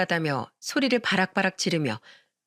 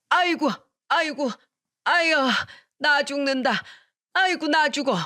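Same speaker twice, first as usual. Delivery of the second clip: high wailing cries of pain, hammed up; mother-in-law wailing; 6 semitones higher than usual. A narrator's reading. Mother-in-law, knocked down by her son, wails in mock pain.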